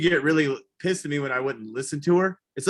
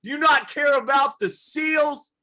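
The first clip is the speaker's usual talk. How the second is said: high, loud scolding; mock outraged shout; 12 semitones higher, 5 dB louder than usual. Comic scolding his mother in a high mock shout. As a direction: yell at mom over the cups. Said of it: "And I was like".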